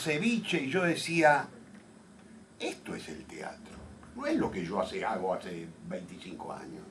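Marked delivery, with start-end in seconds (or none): 2.6-6.8 soft, half-whispered retold thought; hushed realization. Playwright at the Peruvian restaurant, softly whispering his epiphany. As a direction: murmur it as a private revelation.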